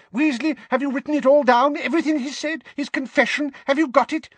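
very high, loud, excited questioning; quivering with delight. A narrator's reading. Minister checking on his note-taker, quivering with delight. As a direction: fire off questions in breathless glee. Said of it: "positively quivering with delight".

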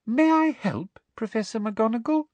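high, sugary offer with a rising end; poisonously sweet. A narrator's reading. Umbridge offering unwanted help to professor, poisonously sweet. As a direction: coo it in a high, falsely sweet voice. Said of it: "in her most poisonously sweet voice".

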